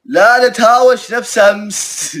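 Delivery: pleading with viewers not to fight, in a high, slow mock whine. Shown as a demonstration. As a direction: stretch out نتهاوش in a high, drawn-out mock plea. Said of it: "من الحين أقول لكم".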